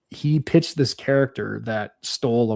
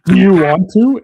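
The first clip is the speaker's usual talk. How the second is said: eager grin; 5 semitones higher, 9 dB louder than usual. Retold or imitated. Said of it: "I was like".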